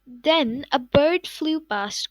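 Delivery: usual speaking voice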